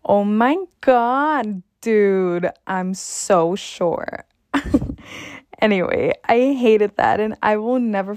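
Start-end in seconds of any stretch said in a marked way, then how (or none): 0.0-4.2 put-on slow, drawn-out Valley girl voice; Valley girl accent. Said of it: "it went a little like this". 4.5-5.5 breathy laugh. Real, not acted. Laughs at own impression.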